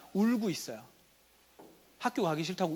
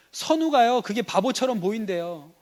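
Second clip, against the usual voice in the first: put-on complaining child; 4 semitones higher, 6 dB louder than usual. Child confiding a grievance, acted.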